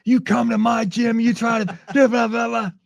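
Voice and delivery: high, loud mock rant at the fighter; retold angry yelling. Coach yelling at the sparring partner, mock angry. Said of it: "Matt starts yelling at him".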